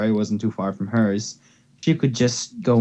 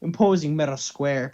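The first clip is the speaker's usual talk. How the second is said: usual speaking voice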